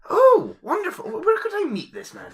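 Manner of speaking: delighted, high and loud; excited. Acted. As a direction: burst out with eager delight. Excited at meeting author.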